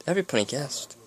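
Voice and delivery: usual speaking voice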